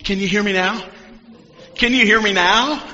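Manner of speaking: playful imitation of God calling out. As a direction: check the line like someone calling on a phone. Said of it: "I can almost see God going".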